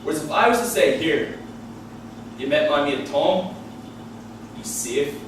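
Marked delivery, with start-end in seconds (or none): 1.0-5.1 low, slow Belfast accent with menace; Belfast threat. Shown as a demonstration. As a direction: dour Belfast vouching that sounds ominous. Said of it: "sounds like I've done a fucking background check".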